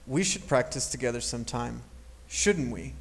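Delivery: "shouldn't we" level, no rise. Shown as a demonstration. Teacher reviewing the opinion tag, level tune. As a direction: keep "shouldn't we" flat like the statement. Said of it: "there is no rising intonation".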